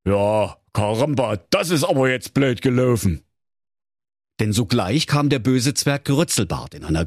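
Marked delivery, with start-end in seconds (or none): 0.1-3.2 king's voice. Acted. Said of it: "Und der König sprach".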